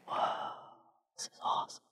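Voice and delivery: whispered, awed "whoa, this is awesome"; hushed students in awe; reverential hush. Retold or imitated. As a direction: breathe it out in wonder. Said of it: "in just reverential quiet".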